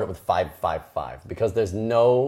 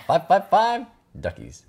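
ad voice; 11 semitones higher, slower than usual. Pretend. Reading the ad number.

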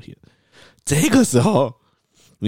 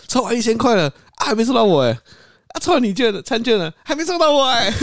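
excited; 4 dB louder than usual. Retold or imitated. Quoting friend's running raffle texts.

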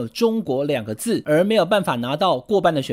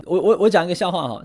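usual speaking voice

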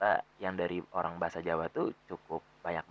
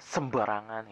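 Betawi exclamation; 8 semitones higher, much slower than usual. Retold or imitated. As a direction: blurt it like a brash Betawi. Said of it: "itu contoh merupakan bahasa Betawi".

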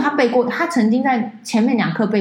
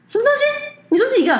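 disbelieving, much higher squeak; shocked. Retold or imitated. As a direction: react as if you can't believe the number. Shocked reply on phone. (惊讶得拔高嗓门)

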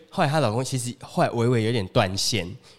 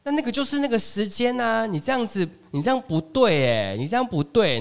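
higher pitched mock scolding; imitating scolding wife; 9 semitones higher than usual. Wife scolding husband over the misread timetable, high mock nagging. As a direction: pile on the blame like a nagging wife.

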